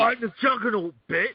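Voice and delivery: mock movie-villain boast, pitched high; hammed-up tough-guy catchphrase; Juggernaut. Co-host quoting a lame film villain, hammed-up boast. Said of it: "what they did to the Juggernaut".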